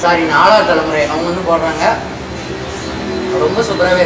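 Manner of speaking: wet mouth after drinking; speaking between sips of juice; just after a sip. Real, not acted. Seen 1: Sips juice at -1.0, talks right after, glass lowered.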